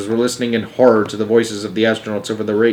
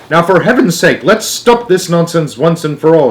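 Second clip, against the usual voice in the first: mildly stern, higher-pitched scolding; 5 semitones higher, 4 dB louder than usual. A narrator's reading. Wonka telling the passengers off, sternly. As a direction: put a firm foot down. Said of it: "Mister Wonka said sternly".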